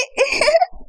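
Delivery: short high giggle. Acted. Second girl giggling at the washing machine. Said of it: "क्यों हंस रहे हैं?".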